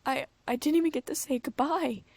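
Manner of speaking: fighting back tears; choked out. A narrator's reading. Davina grieving, choking out the words. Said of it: "I choked out".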